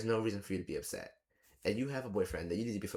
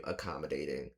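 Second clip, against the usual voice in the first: dry sarcastic comment; 7 semitones lower, 4 dB quieter than usual. Real, not acted. Sarcastic label after quote.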